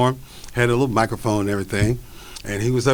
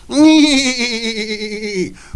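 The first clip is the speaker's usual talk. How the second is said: real laugh; 9 semitones higher, 4 dB louder than usual. Real, not acted. Laughing at own routine.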